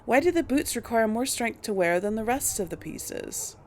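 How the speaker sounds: usual speaking voice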